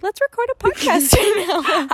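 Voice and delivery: put-on high, loud, laughing drunk voice; drunk friend voice. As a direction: mimic a giddy drunk friend. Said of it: "Eleanor's so fucking drunk. She's like".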